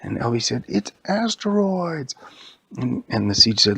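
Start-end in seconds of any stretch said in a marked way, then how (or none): 0.7-2.1 high, drawn-out cry of discovery; acted alarm. Elvie as huge asteroids come down, acted alarm. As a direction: stretch it out high, announcing incoming danger. Hero sees asteroids falling.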